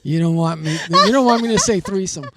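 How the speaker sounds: loud laughing tease. Real, not acted. Teasing, laughing while joking.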